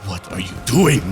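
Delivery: trembling, overwrought elf voice; emotional Dark Elf with a tremble. Acted. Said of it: "that tremble in your voice whenever you do a Dark Elf voice".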